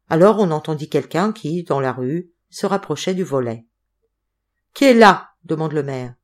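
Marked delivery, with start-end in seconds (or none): 4.7-5.3 loud, high challenge through the door; calling out. A narrator's reading. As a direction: call out to whoever is there. Mayor calls through closed shutter. (appel lancé très fort)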